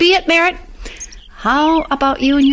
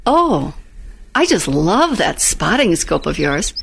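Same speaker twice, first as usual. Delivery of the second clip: gangster's moll; 9 semitones lower than usual. Acted. Vamping over the scope.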